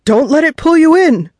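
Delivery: high, loud warning cry; urgent shout. A narrator's reading. Fisherman to rookie holding the netted shark, urgent shout. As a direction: shout it to save your crewmate.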